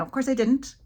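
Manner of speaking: usual speaking voice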